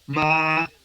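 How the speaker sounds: high, sustained, level ma; first tone. Shown as a demonstration. Teacher going through the tones, high flat first-tone ma. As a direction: keep MA high and even, held long. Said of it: "voy a repetir los tonos".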